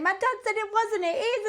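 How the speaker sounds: very high, upset insistence; retold tearful protest. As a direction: re-enact a distressed argument down the phone. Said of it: "I was crying down the phone".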